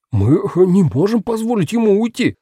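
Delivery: high, agitated objection; gasping protest. A narrator's reading. Stelman protests letting Paxton leave, high agitated voice. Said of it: "Стелман ловил ртом воздух".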